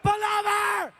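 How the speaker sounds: high frantic shouts to pull over; high panicked shouting at a reckless driver; frantic yell. Retold or imitated. Retold yelling at driver.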